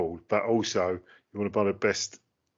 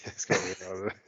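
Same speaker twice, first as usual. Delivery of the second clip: usual speaking voice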